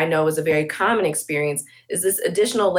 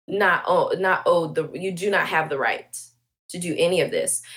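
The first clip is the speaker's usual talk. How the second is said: normal talking voice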